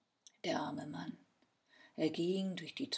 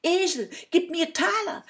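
loud, high-pitched call to the donkey; shouted command; 9 semitones higher, 12 dB louder than usual. A narrator's reading. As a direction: order the donkey loudly. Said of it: "rief der Mann". (laut gerufen)

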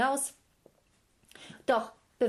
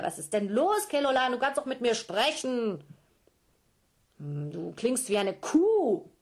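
questioning giant mother; 3 semitones higher, 5 dB louder than usual. Acted.

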